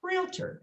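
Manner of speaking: "Realtor" with the first syllable stretched; two-syllable "Realtor", long start. Shown as a demonstration. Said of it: "first syllable's longer".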